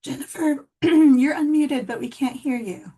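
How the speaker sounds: calling out. Real, not acted. Calling to muted Zoom reader.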